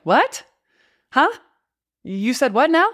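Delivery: mock disbelief. Reacting to the boss's sassy Slack, mock incredulous. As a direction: act stunned by a rude message.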